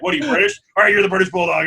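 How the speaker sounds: mock wrestling boss pitching a gimmick; Vince McMahon. Co-host compares Japan to a wrestling boss, imitated loud pitch. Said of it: "acting like Vince McMahon".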